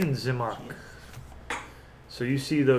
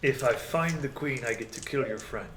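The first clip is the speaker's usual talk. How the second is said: measured villain bargaining; lightly voiced menacing negotiator; Strahd; 4 dB quieter than usual. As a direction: weigh the wager with unhurried authority. Game master plays Strahd bargaining.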